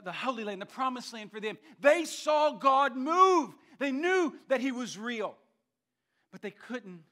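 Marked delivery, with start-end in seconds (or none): 1.8-5.4 slow, high, ringing preacher's shout; real full-force pulpit climax; emphatic shout. Real, not acted. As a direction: proclaim it loudly, stretching each word.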